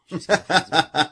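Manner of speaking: mock dramatic vow. Swearing devotion to Sébastien, a hammed-up laughing vow. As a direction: belt it out like a lover's oath, half laughing. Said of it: "C'est à ce point-là que tu m'aimes?".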